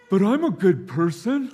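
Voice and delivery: high, loud whiny protest with a laugh; hammed-up self-defence; mock defensive husband. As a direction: play the wounded good guy. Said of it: "And I keep saying".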